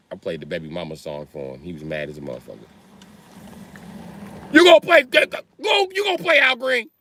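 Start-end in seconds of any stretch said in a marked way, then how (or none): 4.5-6.9 very high furious shout; shouting dad. Retold or imitated. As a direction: yell at the son over his music. Angry father yells in story.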